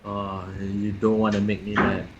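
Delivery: growing threat before a transformation; Hulk lines. Acted. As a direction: rumble a mock-heroic warning. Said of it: "you're doing Hulk lines".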